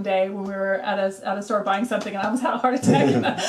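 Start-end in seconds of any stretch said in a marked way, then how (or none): 2.1-3.5 real laughter breaking into the words; laughing. Real, not acted. Telling co-host about a friend's crumpled cash, laughing. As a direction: tell it while cracking up. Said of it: "I'm laughing because".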